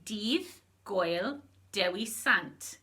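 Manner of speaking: Dydd Gwyl Dewi Sant said slowly, DEETH GWEEL DEH-wee SANT; Welsh phrase, slow. Shown as a demonstration. [name] teaching the Welsh greeting, slow careful demo. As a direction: break the phrase apart slowly for learners. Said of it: "To say Saint David's Day, you say".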